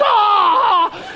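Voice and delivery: high, loud drawn-out "aah"; imitated shriek of derisive laughter; mock howl of hilarity. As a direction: howl as if falling about. Imitates neighbours falling about.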